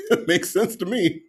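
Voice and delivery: high-pitched words squeezed out through laughter; laughing while talking. Real, not acted. Host agreeing with a viewer's joke, laughing hard. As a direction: agree while helplessly cracking up.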